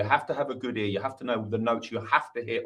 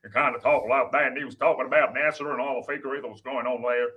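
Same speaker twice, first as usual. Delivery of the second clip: cowboy accent. Shown as a demonstration. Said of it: "the cowboy accent that I did".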